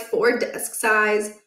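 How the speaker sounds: usual speaking voice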